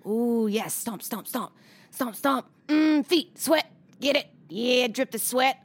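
hammy, gleeful foot-lover voice; greedy glee. Acted. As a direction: slurp it up with greedy relish. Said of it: "Amber's interpretation of feet".

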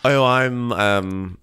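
normal talking voice

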